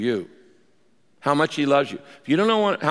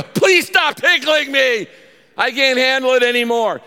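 passionate pleading; 10 semitones higher, 6 dB louder than usual. Acted. Child begging someone to stop tickling, passionate high yell. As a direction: yell it desperately, like a kid tickled too much. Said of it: "And you are passionate".

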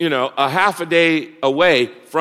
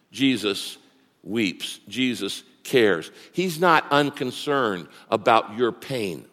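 normal talking voice

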